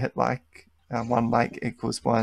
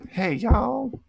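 very high, louder cracked greeting; messed-up voice; 11 semitones higher, 5 dB louder than usual. Pretend. Trying y'all once more for friends, squeaky messed-up voice. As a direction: push the greeting up until the voice goes wrong. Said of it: "Now my voice is a bit fucked".

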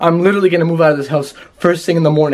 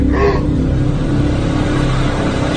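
frightened gasp. Real, not acted. Seeing the house destroyed.

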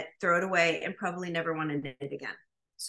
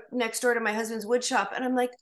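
ordinary delivery

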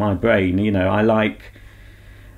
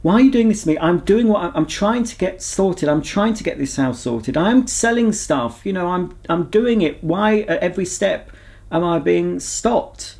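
high, pleading mock outburst, raised pitch; exasperated plea to the sky; 8 semitones higher than usual. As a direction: cry out to the universe, fed up and begging. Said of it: "I'm saying to whoever's being".

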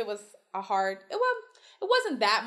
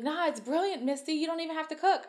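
1 breezy friends; 7 semitones higher than usual. Retold or imitated. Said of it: "They were like".

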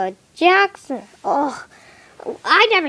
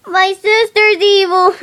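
usual speaking voice

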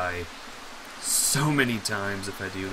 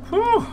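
high relieved whew; 9 dB louder than usual. Real, not acted. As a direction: squeal of relief. Relief after scare.

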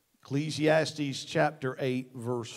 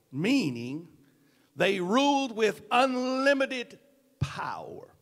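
loud, high, ringing pulpit delivery with hammered words; full-force preaching; 13 semitones higher, 13 dB louder than usual. Acted. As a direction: preach it to the back row, building and pounding. Preaching the sermon body.

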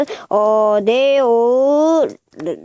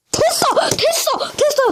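very high, breakthrough exclamations; excited relief; 13 semitones higher than usual. Real, not acted. Reviewer finally getting the toy transformed, high excited cries. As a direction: squeal that it finally worked.

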